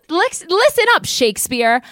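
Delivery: high, loud bossy call; mock telling off. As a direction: yell it at him jokingly.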